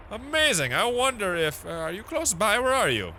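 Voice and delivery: high, wondering voice; high-pitched, curious character voice; awed caller. Acted. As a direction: marvel at the voice on the line. Acting out awed first phone caller.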